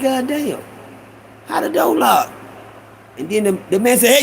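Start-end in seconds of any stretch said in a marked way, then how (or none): 0.0-2.3 high, astonished complaint; baffled disbelief. Retold or imitated. Young thief finds the door locked, high and baffled. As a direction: react pitched up, as if suddenly trapped.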